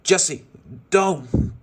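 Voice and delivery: loud, high panicked shout; panicked cry. Acted. Buzz panicking as Jessie charges Tex, loud cry. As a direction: yell to stop her in alarm. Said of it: "Buzz panicked".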